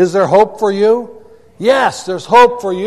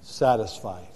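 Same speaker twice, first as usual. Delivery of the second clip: hushed answer; 9 semitones lower, 12 dB quieter than usual. Shown as a demonstration. Preacher answering his own question to the congregation, hushed and low. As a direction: let the answer drop to a hush. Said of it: "and be what?".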